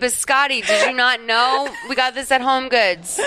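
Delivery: hammed-up indignant explaining to honey; mock proud homeowner. Voices an imagined Italian homeowner, joking.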